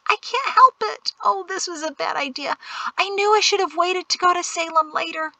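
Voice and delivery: put-on high, childish whine; high-pitched, self-pitying whimper; whiny six-year-old. A narrator's reading. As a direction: complain like a desperate little kid. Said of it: "you sound like a six-year-old".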